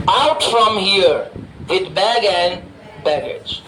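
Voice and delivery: drawn-out phrases with clear gaps; slow dictation. Shown as a demonstration. Teacher dictates the English for "leave with your bags", very slowly. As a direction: give it very slowly for note-taking.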